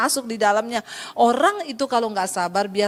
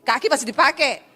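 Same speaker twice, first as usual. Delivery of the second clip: blunt Sulawesi man. Retold or imitated. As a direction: shove them aside with words. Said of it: "itu orang Sulawesi". (orang Sulawesi ketus dan lebih keras)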